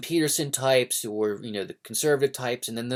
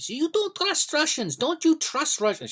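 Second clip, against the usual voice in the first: much higher, put-on old grandma voice; grandmother's warning; 3 dB louder than usual. Grandson imitates grandmother warning him about Russians, high put-on voice. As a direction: squeak it out like a fussy old grandma. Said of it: "she would pull me aside randomly".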